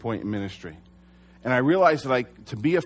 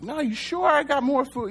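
high, bright eager offer of more food; 13 semitones higher than usual. Retold or imitated. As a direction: keep offering like a happy cook who won't stop. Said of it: "I was just in a great mood".

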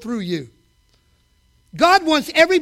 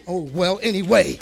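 ordinary delivery

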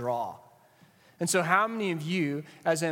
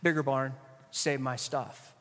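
lower, softer, slow "nah" waving people off; dismissive brush-off; 4 semitones lower, 4 dB quieter, slower than usual. Retold or imitated. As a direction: shrug off those in need. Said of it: "and we're like".

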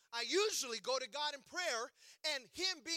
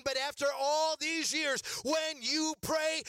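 louder, rising sung-out sermon cadence; full-force preaching; 4 semitones higher, 6 dB louder than usual. Acted. Preacher building to climax before congregation, singing out.

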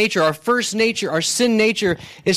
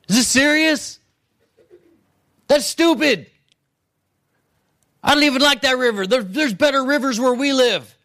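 higher-pitched, hammed-up indignation; offended disbelief; 4 semitones higher than usual. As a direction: play a proud man insulted by silly instructions. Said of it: "But Naaman became angry".

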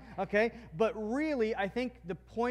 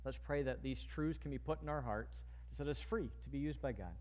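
soft, unhurried pastoral prayer; 3 semitones lower, 6 dB quieter than usual. Acted. Pastor praying for the congregation, hushed and earnest. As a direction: pray quietly on behalf of the church.

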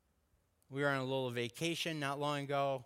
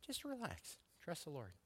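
much softer, gentle reassurance; soft calming wife; 3 semitones higher, 14 dB quieter than usual. Retold or imitated. Wife calming her husband about seats, softly. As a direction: hush him like a patient wife. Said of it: "You know what my wife was doing?".

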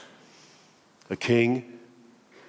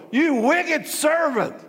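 slow, higher and strained king's roar; 11 semitones higher, slower than usual. Acted. King roaring at the wicked servant, slow furious roar. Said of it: "The king roared".

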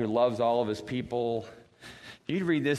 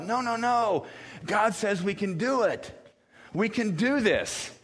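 higher, pleading voice, slowing at the end; pleading in anguish; 8 semitones higher than usual. Retold or imitated. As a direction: beg them to believe, slowly. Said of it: "kind of this anguish".

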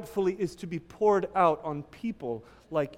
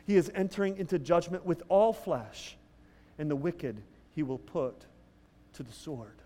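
a quiet, measured reading of the verse; scripture reading; 3 semitones lower, 5 dB quieter than usual. Shown as a demonstration. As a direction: read it softly, like holy text. Reads scripture verse aloud.

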